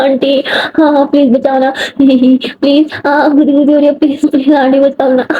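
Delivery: laughing as she begs for help; acted ticklish giggles between pleas, words stretched by laughter; giggling plea. Tickled girl begs for rescue.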